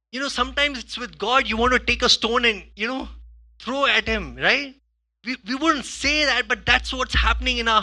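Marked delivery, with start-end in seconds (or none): none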